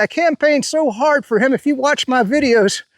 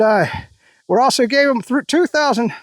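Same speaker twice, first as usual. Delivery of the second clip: ordinary delivery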